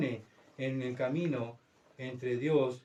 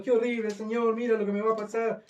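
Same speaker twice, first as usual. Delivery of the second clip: high-pitched fake wail; hammed-up tearful lament, held high; mock crying; 5 semitones higher than usual. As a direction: imitate a martyr whining in tears.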